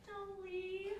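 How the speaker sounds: put-on high, small child's plea. Imitating the ghost child for the listeners, high and pleading. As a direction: pitch it way up like a little ghost child. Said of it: "this old child's voice say".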